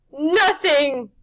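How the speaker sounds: high, loud falling crone; fading witch. Acted. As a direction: let it die out. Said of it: "she said again".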